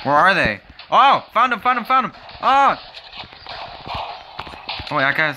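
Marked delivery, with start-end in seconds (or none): 0.9-2.8 high-pitched excited call-out; excited alarm. Real, not acted. Player has just found the enemy, excited alarm. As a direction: yelp it out on spotting the target.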